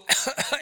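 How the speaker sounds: mock cough. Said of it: "and we'll go".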